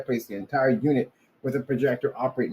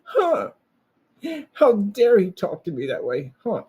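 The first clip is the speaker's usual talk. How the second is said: high, loud offended huhs, slower; mock indignant huh; 8 semitones higher, 4 dB louder, slower than usual. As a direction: ham up the outraged how dare he. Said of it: "I'm like".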